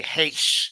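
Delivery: Heish with a breathy h and a "sh" ending; mocking Heish, drawn out as "haysh"; mimicked mispronunciation. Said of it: "pish poor accent that you have when you say".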